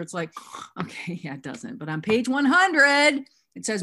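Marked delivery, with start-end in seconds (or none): 1.8-3.2 loud, high, slow proclamation; drawn-out, forceful emphasis; emphatic rebuttal. Real, not acted. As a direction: belt it out as the winning proof.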